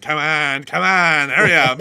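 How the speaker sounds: loud, impatient New York yell; loud New Yorker hurrying a hot dog vendor. Retold or imitated. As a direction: rush them like at a hot dog stand. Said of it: "like a New Yorker ... rushing a hot dog stand".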